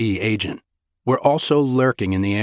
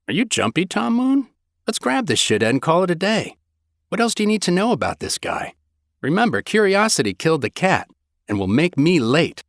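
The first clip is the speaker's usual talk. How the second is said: higher, quick teasing banter; teasing woman voice; 9 semitones higher than usual. A narrator's reading. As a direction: tease him like a sassy partner.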